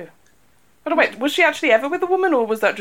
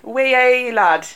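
put-on Geordie accent; 7 dB louder, much slower than usual. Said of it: "Can you do a Geordie accent?".

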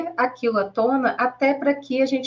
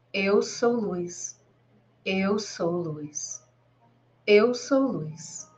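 slow, measured meditation voice with long pauses; guided meditation; slower than usual. Acted. Guide leading listeners to breathe and relax, slow guided-meditation voice. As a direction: guide the listener gently through breathing, drawing words out.

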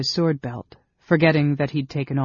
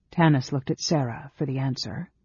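ordinary delivery

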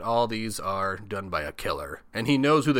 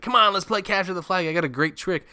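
high, louder eager pitch; eager urging; 9 semitones higher, 6 dB louder than usual. Retold or imitated. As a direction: sell the sneaky plan. Ricky urging Angela.

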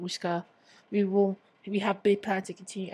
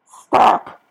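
much louder sudden cry; sharp shout; 17 dB louder than usual. Real, not acted. Scolding herself mid-rant, shouting. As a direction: cut yourself off with a yell.

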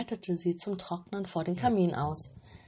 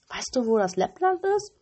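put-on robber girl voice; 5 semitones higher, 7 dB louder than usual. A narrator's reading. Robber girl asks reindeer.

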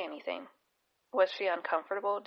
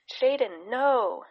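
loud panicked scream, higher; 5 semitones higher, 8 dB louder, much slower than usual. A narrator's reading. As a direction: scream the horse's name in panic. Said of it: "she screamed".

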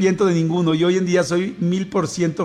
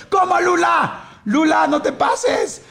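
mock shock; 10 semitones higher, much slower than usual. Real, not acted. Radio host teasing a listener in mock outrage.

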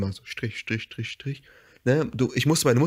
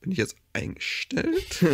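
high, soft disbelieving question; retelling the job interview, a higher mock-confused question; baffled question; 10 semitones higher, 4 dB quieter than usual. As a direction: ask it as if you can't believe it. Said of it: "Und ich so".

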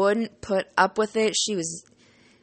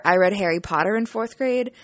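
ordinary talk, nothing marked

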